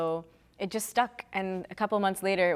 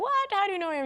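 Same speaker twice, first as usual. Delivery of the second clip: high-pitched retold astonishment; surprised disbelief; 12 semitones higher than usual. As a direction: react as if caught completely off guard. Retelling her surprised reply.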